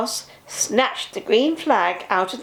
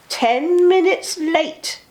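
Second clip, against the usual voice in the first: slow complaint; 7 semitones higher, 3 dB louder, slower than usual. A narrator's reading. Guard complaining slowly.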